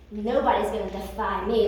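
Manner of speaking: low, smug tyrant boast; a proud king, sure of himself. Acted. King on his throne boasting to himself, low and smug.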